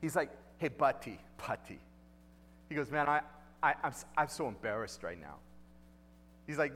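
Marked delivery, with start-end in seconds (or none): 0.6-1.8 low retold Puerto Rican accent. Pastor retelling a guy's brush-off, in Puerto Rican accent. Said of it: "he had a cool accent". 2.9-5.4 lightly imitated Puerto Rican accent, trailing low; hint at his Puerto Rican accent. Embarrassed guy to pastor, light Puerto Rican accent. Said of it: "he had a cool accent".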